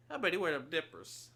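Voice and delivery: put-on high country-local accent. Mimics locals judging footwear.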